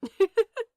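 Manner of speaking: a bright, high little laugh; light laugh. A narrator's reading. To Crowley, amused by his fear: a bright little laugh. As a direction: laugh fondly, up and out.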